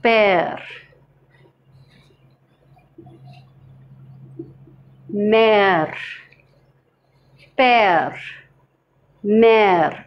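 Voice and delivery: père, mère, open air vowel, falling; teacher drilling père and mère; French pronunciation. Shown as a demonstration. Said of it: "So the sound is air".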